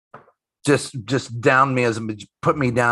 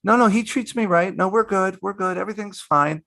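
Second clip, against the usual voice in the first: mock reassuring wife; 9 semitones higher than usual. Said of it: "on the phone to her mom and dad and going".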